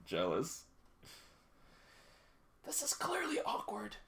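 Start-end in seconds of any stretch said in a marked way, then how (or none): 2.6-4.0 high breathy whisper. Real, not acted. Streamer whispering over an awkward scene. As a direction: hush it like a secret.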